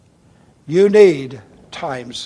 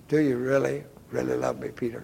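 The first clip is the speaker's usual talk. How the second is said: softening question, dropping away to the name; hushed, intimate questioning; gentle probing; 2 semitones lower, 5 dB quieter than usual. Acted. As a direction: ask it quietly and searchingly. Jesus gently asks a third time.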